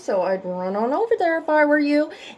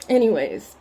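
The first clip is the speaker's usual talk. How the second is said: normal talking voice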